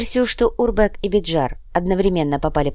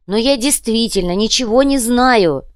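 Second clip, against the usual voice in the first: higher, louder, slow earnest protest; very honest protest; 6 semitones higher, 6 dB louder than usual. A narrator's reading. Hamzi tells the doubting magister, very honestly. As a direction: plead your innocence emphatically. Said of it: "очень честно произнёс Хамзи".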